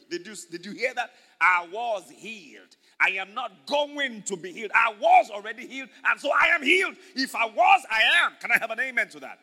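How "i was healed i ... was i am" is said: loud, building preacher's proclamation; emphatic declaration. Acted. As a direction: punch WAS and AM, rising to a shout. Preacher declares healing as believer.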